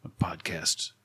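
soft, low meditation voice; guided meditation. Acted. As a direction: ease listeners in softly, with pauses. Puts on guided meditation host voice.

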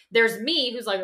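usual speaking voice

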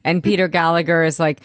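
normal talking voice

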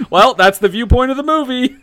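high, laughing sarcastic quip; laughing sarcasm. Retold or imitated. Sarcastic aside while laughing.

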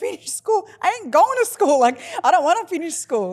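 high, loud, outraged disbelief; incredulous teen. Retold or imitated. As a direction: scoff like a teenager refusing. Her incredulous teenage reply.

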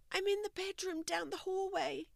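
soft, high, pained whimper. A narrator's reading. Stacy whimpers to Fiona from the bedroom, softly. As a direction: barely get the words out in pain. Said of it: "Stacy whimpered".

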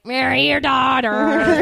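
slow, loud parrot squawk. Retold or imitated. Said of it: "He's like".

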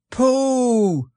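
high, delighted shout of greeting; joyful cry. A narrator's reading. Christopher Robin finds his lost friend, joyful cry. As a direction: cry out high on finding him. Overjoyed reunion, calling his name.

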